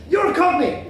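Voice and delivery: loud, high excited outburst; thrilled, pitched right up; delighted discovery. Acted. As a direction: exclaim with delight at a rare find. Delighted at finding a Cockney.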